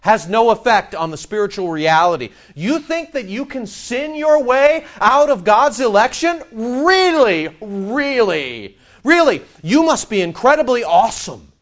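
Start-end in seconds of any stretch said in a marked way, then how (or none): 2.5-11.5 high, incredulous preacher's challenge. Real, not acted. Preacher challenges those who think they can out-sin election, incredulous. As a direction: throw each "really" out in disbelief. Mocks idea of sinning out of election.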